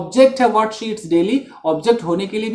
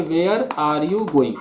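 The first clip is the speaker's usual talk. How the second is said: slow correct version; 3 semitones lower, 3 dB quieter, much slower than usual. Shown as a demonstration. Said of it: "हम बोलेंगे".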